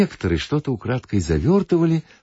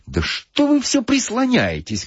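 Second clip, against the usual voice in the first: high-pitched irritated outburst, slowed; irritated shout. Acted. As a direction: snap at the man leaning on you. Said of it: "крикнул на него нервный господин".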